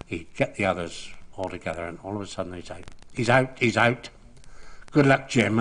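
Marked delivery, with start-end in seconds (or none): 3.1-5.6 loud urgent call. Retold or imitated. Jack fooling a batsman round the corner, loud call. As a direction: shout the false alarm to the dressing room.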